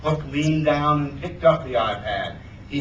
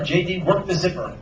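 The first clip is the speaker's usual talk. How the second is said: usual speaking voice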